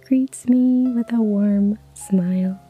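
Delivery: usual speaking voice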